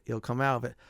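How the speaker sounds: normal talking voice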